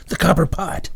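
copper pitched high in surprise; sudden realisation. A narrator's reading. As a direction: grab the idea: the copper pot!. Sudden idea, copper pot.

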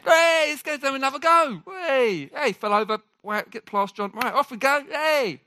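high, delighted cheers; cheering encouragement. Acted. Parent urging a toddler to have another go, cheering encouragement. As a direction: cheer on a little one who has fallen over. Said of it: "claps and cheers".

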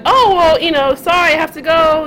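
high, polite excuse; apologetic young self. Retold or imitated. Excusing herself to the men in the van, high and apologetic. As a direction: mimic a kid excusing herself sweetly. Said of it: "I was like".

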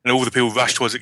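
ordinary talk, nothing marked

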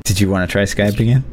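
ordinary delivery

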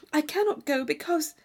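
looking down shyly; a hint of shy hesitation; hesitant Catherine.

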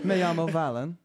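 a Brit trying out Spanish, slowly; British accent in Spanish. Shown as a demonstration. Dared by a friend, he speaks Spanish in a British accent. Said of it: "try doing a British accent while speaking Spanish".

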